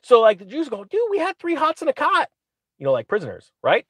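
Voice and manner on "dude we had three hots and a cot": much higher mock complaint; whiny slave voice. The slaves griping at Moses in a high mock whine.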